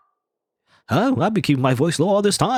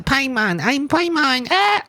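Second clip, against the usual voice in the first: very high squeaky cartoon companion; high-pitched Paimon impression ending in a squeal; 13 semitones higher, much slower than usual. Shown as a demonstration. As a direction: crank the voice way up like Paimon. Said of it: "Paimon voice".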